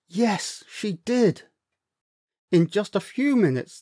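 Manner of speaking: plain reading voice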